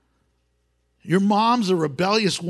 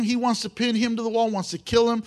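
ordinary talk, nothing marked

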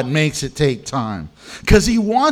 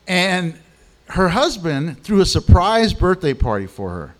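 ordinary delivery

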